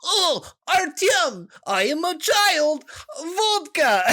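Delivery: high, slow mock Russian accent with a put-on child voice; mock a bad voice actor faking a Russian kid. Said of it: "you'd see a kid and they're like".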